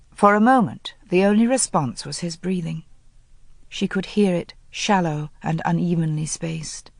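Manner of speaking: ordinary talk, nothing marked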